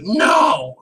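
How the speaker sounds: sudden high shouted no; mock shout. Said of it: "And then when Tommy's like".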